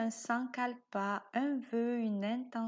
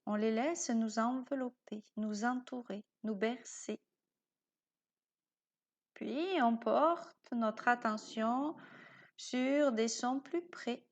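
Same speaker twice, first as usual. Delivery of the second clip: guided meditation. Acted. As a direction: lull the listener towards sleep. Guide une séance de yoga nidra. (voix de méditation guidée, lente et douce)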